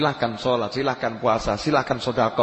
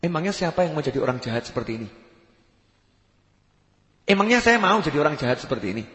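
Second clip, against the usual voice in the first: ordinary delivery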